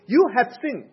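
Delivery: loud, stern rebuke, words hammered down; righteous anger. Retold or imitated. Moses rebuking the people in righteous anger, loud and stern. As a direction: thunder the verdict at the sinner. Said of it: "in righteous anger".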